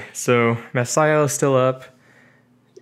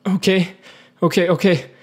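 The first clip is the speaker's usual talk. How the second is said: anxious agreement; 6 semitones higher than usual. Acted. Character reluctantly accepts the promise.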